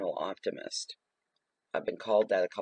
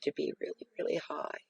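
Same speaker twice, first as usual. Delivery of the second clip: a touch higher and softer while describing it; lightly demoed high voice; 6 semitones higher, 4 dB quieter than usual. Shown as a demonstration. Describing the therapist's wished-for voice, lightly higher and softer. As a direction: lift the pitch up as you describe it. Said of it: "really high, very, very soft".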